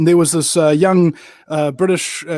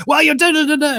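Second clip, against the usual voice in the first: mock dismissive babble; 9 semitones higher, 4 dB louder than usual. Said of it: "And he goes".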